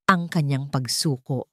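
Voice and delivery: plain reading voice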